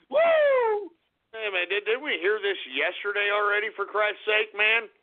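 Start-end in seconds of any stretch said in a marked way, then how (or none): 0.1-0.9 very high, sustained woo; whoop. Real, not acted. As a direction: let out a high-pitched whoop. Host whoops after insult.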